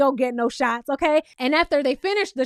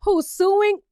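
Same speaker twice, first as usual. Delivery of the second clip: mock disbelief; 6 semitones higher, slower than usual. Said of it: "I'm like".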